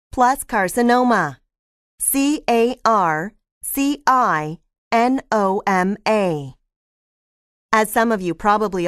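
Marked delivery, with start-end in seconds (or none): 2.0-6.5 slow spelling in small groups of letters; spelled out. Shown as a demonstration. Lecturer spelling carcinoma for the class, slowly letter by letter. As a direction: spell it out slowly for note-takers. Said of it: "I'll spell them for you".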